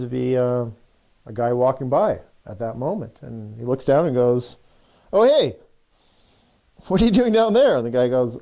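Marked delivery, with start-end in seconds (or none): 5.1-7.8 much higher, louder call down into a hole. Retold or imitated. Passerby calling down to the man in the pit, loud and high. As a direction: hail someone below you, surprised.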